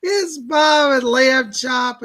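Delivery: loud, high, slow showman hello; excited greeting. Real, not acted. Host and puppet greet the live viewers, loud excited hello.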